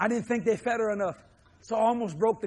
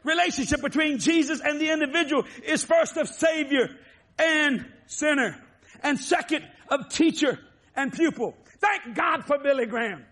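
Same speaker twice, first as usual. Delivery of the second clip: preaching; 5 semitones higher than usual. Acted. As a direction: preach it out to the congregation. Preaching at full force.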